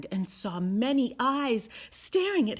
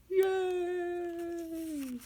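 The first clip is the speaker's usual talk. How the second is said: cheer; 9 semitones higher than usual. A narrator's reading. Story triumph cheer.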